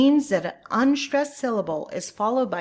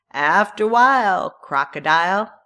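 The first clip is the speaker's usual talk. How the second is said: playful goodbye; 8 dB louder than usual. Shown as a demonstration. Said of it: "Have you ever used these".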